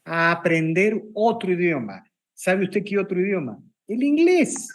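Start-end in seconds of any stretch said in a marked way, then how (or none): 3.9-4.7 El inglés punched high and loud as a punchline; emphatic reveal. Real, not acted. Rhetorical reveal of the answer.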